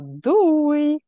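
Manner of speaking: high, drawn-out farewell call; high-pitched loud goodbye. Shown as a demonstration. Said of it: "ilyen nagyon magas hangon, és így nagyon hangosan".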